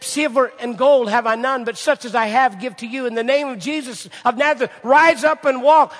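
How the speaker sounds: loud, high commanding cry, slowing on rise up and walk; Peter's command. Retold or imitated. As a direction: proclaim it like Peter healing the lame man. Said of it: "and said".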